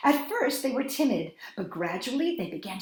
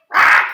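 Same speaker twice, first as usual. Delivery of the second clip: loud parrot squawk; 16 dB louder than usual. A narrator's reading.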